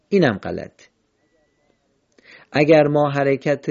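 ordinary talk

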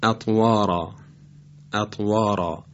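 اطوارا with a thick, heavy ra. Shown as a demonstration. Tajweed teacher giving an example, heavy ra in اطوارا. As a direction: make the r and final alef of اطوارا full and dark. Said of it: "باید تفخیم بشه".

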